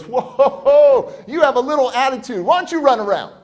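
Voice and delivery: loud, high, hearty "whoa"; imitated cheery man; jovial surprise. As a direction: boom it out at the sulky kid. Cheerful man reacts to rude kid.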